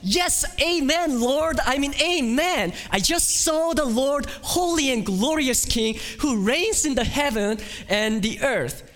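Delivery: high, loud rejoicing amen; joyful exclamation. Pretend. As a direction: cry out in overjoyed praise as Isaiah. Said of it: "I would think to myself".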